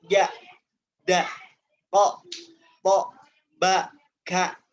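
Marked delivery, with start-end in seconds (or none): 1.1-4.6 tertekan, tekan setiap huruf; pressed letters. Shown as a demonstration. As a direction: hit every syllable hard and held.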